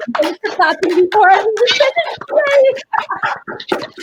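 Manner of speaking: fast, high, bubbling with delight; delighted cheer. Real, not acted. Laughter coach cheering her clapping class, giddy and high. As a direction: cheer the group on with glee.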